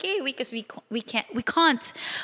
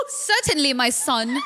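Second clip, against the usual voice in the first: proper mock British reply; British accent; slower than usual. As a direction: answer in a playful put-on British accent. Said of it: "pero British accent siya".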